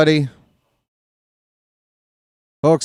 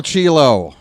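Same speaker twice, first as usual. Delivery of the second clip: ordinary delivery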